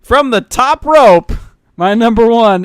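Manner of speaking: loud, high, drawn-out ringside hype; mock wrestling announcer. Nick introduces his number one pick, mock wrestling announcer. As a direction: announce it big and slow, like a wrestling call.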